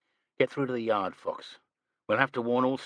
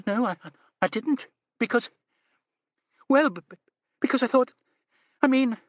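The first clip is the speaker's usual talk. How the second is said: high, loud, tripping over words; flustered stammer; 5 dB louder, faster than usual. A narrator's reading. Miss Lee stammering under the superintendent's question. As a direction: stammer in embarrassed panic.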